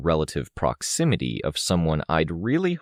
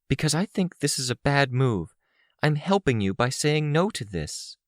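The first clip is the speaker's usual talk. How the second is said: higher-pitched voice, insisting; earnest Amy; 10 semitones higher than usual. A narrator's reading. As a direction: plead your loyalty in a high voice. Narrator voices Amy explaining her refusal.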